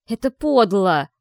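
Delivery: громко и медленно, с возмущением; бросай обвинение громко; angry. A narrator's reading. Continuing the furious struggle. (гневный возглас)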